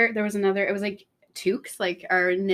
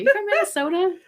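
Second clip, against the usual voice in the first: much higher, louder "You from Minnesota?"; mocking Americans; 12 semitones higher, 6 dB louder than usual. Americans asking the Canadian where she's from, high mock voice. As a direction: toss it off like a curious American. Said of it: "That's what they'd always say".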